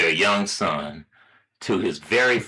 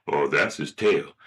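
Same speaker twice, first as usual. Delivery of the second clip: low, offhand dad voice; lower, matter-of-fact dad; father; 6 semitones lower than usual. Acted. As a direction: answer as a father, pitched down. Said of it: "Hey, Dad".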